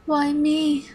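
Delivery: high mock-plaintive question; mocking plea. Computer villain mimics its tortured captives, mock pleading. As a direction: mimic a victim's whine, held back.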